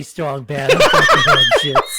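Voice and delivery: laughing mock peasant squeal. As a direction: screech it while cracking up. Joins the accent bit laughing.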